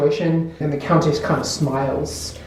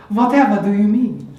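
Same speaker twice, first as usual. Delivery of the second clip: higher, louder theatrical Countess voice; haughty Countess; 5 semitones higher, 5 dB louder than usual. Acted. Game master redoes the Countess's reply to Karen, haughty and theatrical. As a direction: play an affected grande dame, projected.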